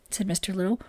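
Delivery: plain reading voice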